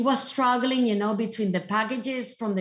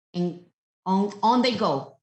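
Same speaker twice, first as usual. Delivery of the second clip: normal talking voice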